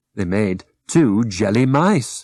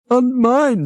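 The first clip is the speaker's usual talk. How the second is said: little pig voice; 13 semitones higher, 3 dB louder than usual. Acted. Second pig squeaks.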